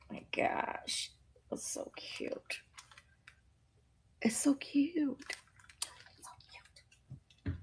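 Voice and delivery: soft whispered delight; hushed, adoring whisper; whispered gush. Real, not acted. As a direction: whisper how cute it is. Whispering over a cute bear.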